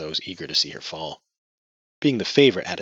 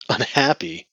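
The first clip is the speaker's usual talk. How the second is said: scornful snort; 8 semitones higher, 6 dB louder, slower than usual. Acted. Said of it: "Martina snorted".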